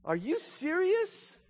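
slow, rising disbelief, climbing high; incredulous father. Acted. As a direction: stretch the question out in mock astonishment. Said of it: "the fathers must be shaking his head".

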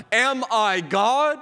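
high, drawn-out imitated outcry; retold cry. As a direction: cry it out slowly, high-pitched, without shouting. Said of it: "tear his clothes and cry out".

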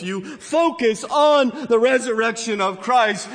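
rousing, emphatic pulpit declaration; full-force preaching. Real, not acted. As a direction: preach it out at full volume, stretching the key words.

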